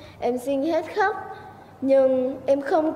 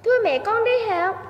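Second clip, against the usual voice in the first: put-on young boy; 8 semitones higher than usual. Boy bids mother goodbye.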